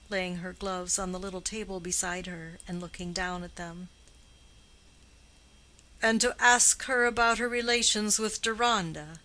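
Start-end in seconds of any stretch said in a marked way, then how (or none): 6.0-9.2 cold, sneering question, louder; contemptuous husband's icy sneer. Acted. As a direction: sneer the accusation at her. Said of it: "with the coldest possible sneer in his low voice".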